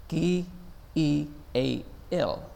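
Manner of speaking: K E A L spelled letter by letter. Shown as a demonstration. Teacher spelling K E A L to the class. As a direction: spell out K E A L for keep everyone alive. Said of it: "an acronym".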